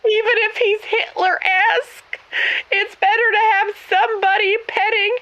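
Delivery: hammed-up weepy whine; mock crying. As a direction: plead through mock tears. Continues tearful pleading.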